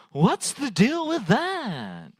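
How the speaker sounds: high, slow, drawn-out "what's the deal" whine; Jerry Seinfeld. Retold or imitated. Comic doing his Seinfeld bit for the crowd, slow and high. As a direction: stretch it out like an observational comic. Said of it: "That was my Jerry Seinfeld impression".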